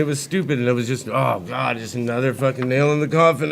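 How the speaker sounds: usual speaking voice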